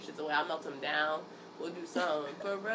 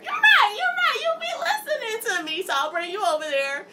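much higher, louder, gushing praise; mock-delighted mom; 13 semitones higher, 8 dB louder than usual. Mom praises her son for thinking ahead, much higher and gushing. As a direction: overdo the proud mother, laughing through it.

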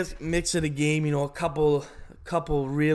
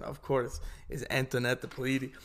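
usual speaking voice

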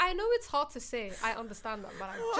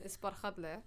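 Barkhadle said the Somali way, guttural kh; Somali accent; 6 semitones lower, 12 dB quieter, slower than usual. Shown as a demonstration. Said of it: "if I say it in a Somali accent".

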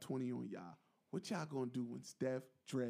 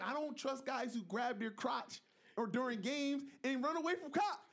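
high-pitched mock indignation; sports pundit; 9 semitones higher than usual. Panelist quoting a pundit on the quarterback, high imitated protest. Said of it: "he's like".

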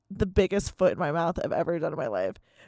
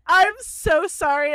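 flustered apology; 9 semitones higher, 7 dB louder, slower than usual. Retold or imitated. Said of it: "I was like".

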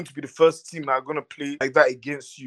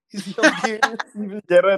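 laughing; 4 semitones higher than usual. Shown as a demonstration. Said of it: "in my best Scouse accent".

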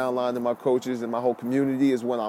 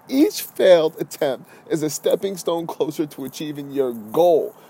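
ordinary talk, nothing marked

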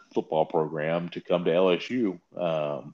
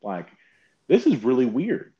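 high, loud incredulous rant; baffled outburst; 6 semitones higher, 4 dB louder than usual. Retold or imitated. Reacting to the coach's new Southern accent, high and loud. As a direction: act baffled at the sudden accent. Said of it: "And I was like".

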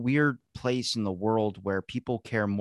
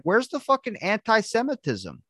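incredulous commenters; 11 semitones higher than usual. Retold or imitated. Commenters demand where the outrage is, incredulous and high. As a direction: throw up your hands at the missing outrage. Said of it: "everyone's like".

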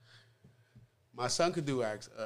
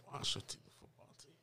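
1 dry whisper; 12 dB quieter than usual. Real, not acted. Repeating the new name.

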